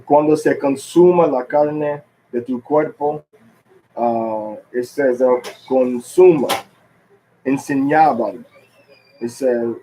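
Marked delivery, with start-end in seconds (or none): 0.1-3.2 Spanish verse with clearly stressed syllables; Spanish reading. Shown as a demonstration. Teacher reading the Bible in Spanish, Spanish pronunciation. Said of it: "I'm gonna read this Bible in Spanish". 5.7-8.4 "consuma" and "enseñaban" with SU and ÑA punched; next-to-last syllable stress. Shown as a demonstration. Teacher picking out Spanish words, stressing "consuma" and "enseñaban" on next-to-last syllables. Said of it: "put the emphasis of the word".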